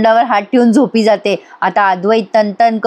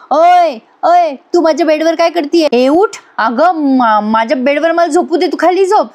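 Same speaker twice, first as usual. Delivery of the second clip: mock yell; 5 semitones higher than usual. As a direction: shout it out in mock alarm, higher pitched. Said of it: "तो जोरात किंचाळतो". (नक्कल केलेली किंचाळी)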